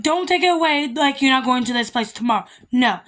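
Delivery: ordinary delivery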